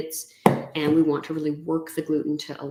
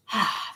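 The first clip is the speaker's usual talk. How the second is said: satisfied sigh; 3 semitones higher, 3 dB quieter than usual. Retold or imitated. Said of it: "the pride and the good feelings".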